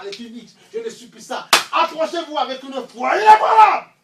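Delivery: loud, rising sermon, building to a high cry; full-force preaching. Acted. Preacher defying Satan before the church, loud preaching. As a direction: hammer each line at the congregation.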